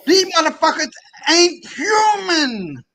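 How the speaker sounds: high, loud, slow cry of rage; a furious drawn-out yell; angry shout. Real, not acted. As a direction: yell it out in fury. Heated rant about ancestors.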